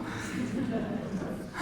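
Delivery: short breathy laugh at the irony; real laugh. Real, not acted. Laughing to the interviewer about the irony. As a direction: laugh at how unlikely it was. Irony of learning Arabic there.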